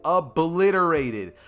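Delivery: slow, punched-up "Obliterated"; emphatic repeat. Real, not acted. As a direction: stretch out "Obliterated" with relish.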